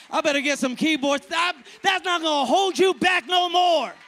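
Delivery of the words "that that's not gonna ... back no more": loud, rising preacher's cry; full-force preaching. Real, not acted. Preacher rousing the congregation at full force.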